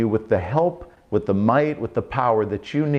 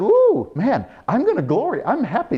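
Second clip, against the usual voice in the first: much higher, giddy excited voice; giddy delight; 12 semitones higher than usual. Retold or imitated. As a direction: gush like you just found treasure. Paul excited, paraphrased.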